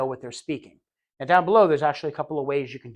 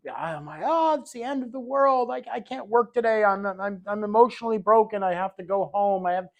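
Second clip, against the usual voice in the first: hammed-up wailing despair, higher; exaggerated mock meltdown, pitched up; mock overreaction; 5 semitones higher than usual. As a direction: play the drama queen falling apart over bad news. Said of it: "you can't overreact".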